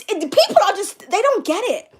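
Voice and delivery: normal talking voice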